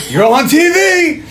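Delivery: high-pitched, drawn-out mock battle yell; over-the-top action-hero holler. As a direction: yell it high and stretch it out, hamming it up. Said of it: "and he yells".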